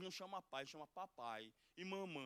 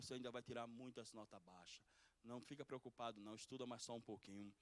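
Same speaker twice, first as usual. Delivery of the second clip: put-on softer, fast, hushed comfort to a crying child; father hugging his sad son, softly reassuring; 3 semitones lower, 8 dB quieter than usual. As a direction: soothe the boy gently.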